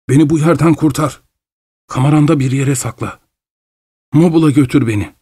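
high, restless voice of a fevered scientist; feverish Doctor Goodbody. Acted. Goodbody introduces himself to the traveller, high and feverish. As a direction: speak up high and jittery, half-mad.

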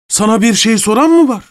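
high-pitched, indignant snub; affronted bully's high retort; scornful outburst. A narrator's reading. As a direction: put the intruder in his place. Masked man snubs the steward.

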